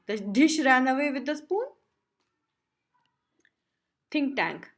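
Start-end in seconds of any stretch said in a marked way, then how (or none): 0.1-1.6 incredulous, laughing lightly, louder; a half-laughed disbelieving question. Acted. Oop laughing at the nursery rhyme, mock disbelief. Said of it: "Oop, laughing".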